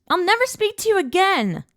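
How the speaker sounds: high, loud playful threat; shrill threat. Acted. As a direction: yell it while being carried. Carried off, protesting.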